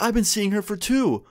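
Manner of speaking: high-pitched retold indignation; mock shock. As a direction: imitate his stunned protest, pitched well up. Other guy's shocked revelation retold.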